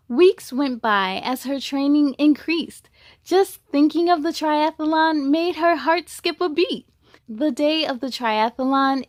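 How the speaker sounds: rhyming storybook read with a steady lilt; picture-book narration; reading to children. A narrator's reading. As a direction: read it to a class of kids. Reads a picture book aloud.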